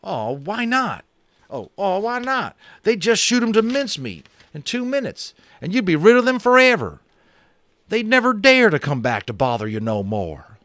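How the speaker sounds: a brash cowboy boasting about guns; cowboy Boone. A narrator's reading. Narrator gives cowboy Boone a high boastful voice.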